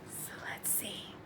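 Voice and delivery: whispered to herself. Real, not acted. Whispering before reading the gift note. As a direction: hush it as you reach for the note.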